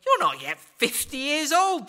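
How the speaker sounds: high, loud, slow mocking jeer; jeering disbelief. Crowd jeering at Jesus, slow mocking disbelief. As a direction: sneer it like they think he's mad. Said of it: "this causes even more jeers".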